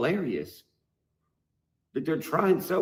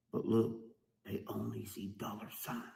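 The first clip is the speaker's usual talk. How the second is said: whisper; 4 semitones lower, 11 dB quieter than usual. Real, not acted. Conspiratorial aside to fans.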